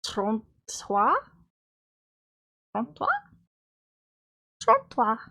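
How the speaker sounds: a hint of French on trente-trois. Shown as a demonstration. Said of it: "I'll stop speaking French now".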